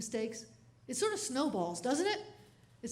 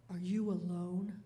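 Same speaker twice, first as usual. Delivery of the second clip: creepy opener; 7 semitones lower, 4 dB quieter, slower than usual. Retold or imitated. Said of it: "Do not start off with".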